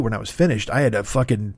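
ordinary talk

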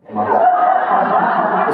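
slow, high, loud laughing oh my God; laughing exclamation. Retold or imitated.

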